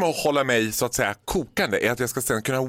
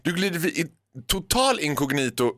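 ordinary talk

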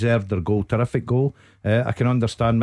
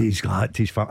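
normal talking voice

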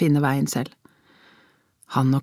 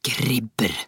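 low, hard, contemptuous word; 5 semitones lower than usual. A narrator's reading. Father curses the media, hard and low. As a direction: spit out the insult in a low voice. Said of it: "sa Aksel hardt".

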